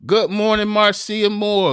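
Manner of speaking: bright loud call of good morning; booming slow good morning; loud greeting. Real, not acted. Greeting on air, calling out.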